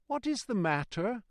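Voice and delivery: high, mildly anxious passenger; mildly quavering. A narrator's reading. Passenger to guard, high and mildly quavering. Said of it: "with mildly quavering speech".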